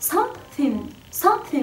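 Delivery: th in something closer to t; American accent something. Shown as a demonstration. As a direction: say something with a light t. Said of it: "بينطقوها أحيانًا something".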